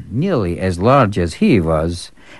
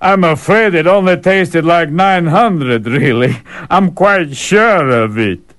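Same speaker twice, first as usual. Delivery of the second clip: high, slow complaining character; fussy eater voice; 7 semitones higher, 3 dB louder, slower than usual. Acted. The eater insisting he is still owed bread, slow. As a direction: plead for more bread.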